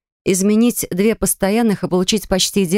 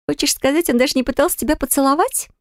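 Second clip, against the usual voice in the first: higher voice, genuinely amazed; a hint of shocked roommate; surprised girl; 7 semitones higher than usual. A narrator's reading. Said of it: "искренне поразилась Нира".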